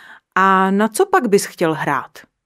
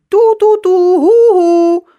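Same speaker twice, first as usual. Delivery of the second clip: blaring trumpet noise, much higher and louder; trumpet blasting; 11 semitones higher, 10 dB louder than usual. A narrator's reading. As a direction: blow it like a terrible trumpet with all your might. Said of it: "ze všech sil foukal do trumpety".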